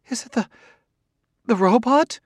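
high, halting woman's voice; falter, then blurt it out high; gasped question. A narrator's reading. Wife realising she faces the robot, gasping. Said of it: "She gasped".

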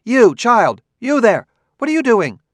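put-on high, loud call to a distant child; loud stern call to a far-off child. A narrator's reading. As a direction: shout across the lawn at a child. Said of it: "Clarissa called out".

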